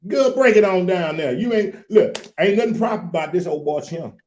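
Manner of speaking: put-on high, excitable country boy; country boy character. Guest invites host to talk with old country boy, in character.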